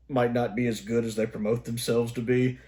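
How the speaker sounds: ordinary talk, nothing marked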